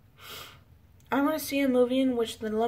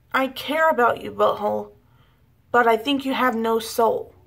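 loud emotional outburst, well above usual volume; bursting out; 11 dB louder than usual. Acted. Woman bursting out at the guy she cares about, loud outburst. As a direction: burst out loudly, punching the whole confession. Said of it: "she never bursts out and says".

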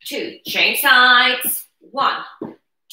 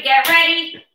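normal talking voice

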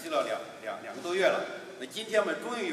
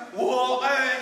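high loud echoing call; 7 semitones higher, 7 dB louder, much slower than usual. Acted. Echo answers the boy, loud high call. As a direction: ring it back as an echo.

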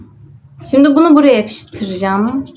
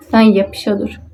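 usual speaking voice

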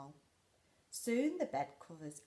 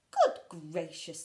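loud, slow good gracious; astonished at the door; startled old man; surprised Percy; 12 dB louder than usual. Acted.

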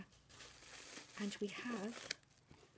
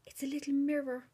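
high, loud thrilled reveal; delighted exclamation; 5 semitones higher, 8 dB louder than usual. Real, not acted. Finding Karina's little mirror, thrilled and loud. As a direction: announce the find with glee.

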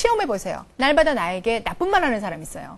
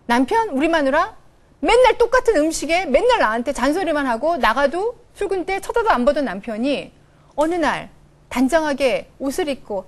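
ordinary talk

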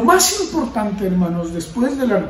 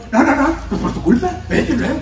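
higher, laughing imitation of a defiant child; mocking defiant child; 4 semitones higher than usual. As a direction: play the child shoving the parent off, half-laughing. Child talking back to parent. (imitación burlona de un hijo rebelde)

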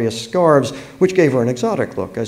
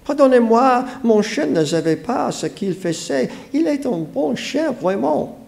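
put-on high-pitched girl apologizing in French; French-speaking girl; 11 semitones higher than usual. A narrator's reading. French-speaking girl, voiced in story.

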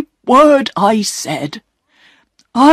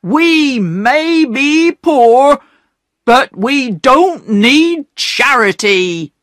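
higher, slow, stubborn mock shout; a poor man refusing charity, mildly raised; proud angry shout; 7 semitones higher than usual. Perks refuses the gifts, a mild hammed-up shout. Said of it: "they heard Perks shout".

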